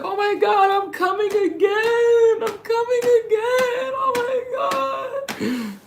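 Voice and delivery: very high falsetto, slow stretched wail; mock a woman screaming and crying at once; imitating screaming, crying woman. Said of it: "she screaming that shit like".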